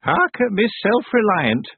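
high, slow teasing witch; mild mockery. A narrator's reading. Miss Treason teasing Tiffany, mildly mocking. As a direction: tease without real nastiness, drawn out. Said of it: "but not in a particularly nasty way".

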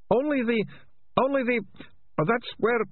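high-pitched faltering villain; start, falter and restart in a high squirming voice; flustered, halting. A narrator's reading. Ratcatcher struggling against confessing.

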